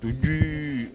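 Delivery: long, held "دجي"; drawn-out offer. Acted. Host repeats the dish to guest, drawn-out word. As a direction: stretch the end of "دجي" out slowly.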